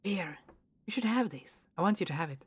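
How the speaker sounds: lightly voiced gentle man; quiet, tender character read; soft character voice. Voicing Ian giving pendant.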